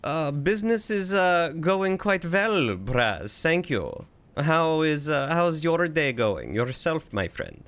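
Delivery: put-on German-ish accent with v for w; chatty mock-German character voice; vaguely German accent. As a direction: do a loose, hammy German accent. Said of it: "Vaguely German is what I use".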